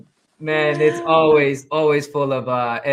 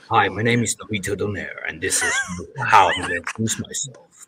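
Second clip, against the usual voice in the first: put-on voice; 6 semitones lower than usual. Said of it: "Can you introduce yourself with that voice?".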